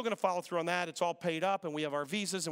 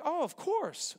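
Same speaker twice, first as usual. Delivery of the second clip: mock breezy; 10 semitones higher, much slower than usual. Mock airport answer.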